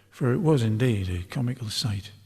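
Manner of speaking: ordinary delivery, nothing marked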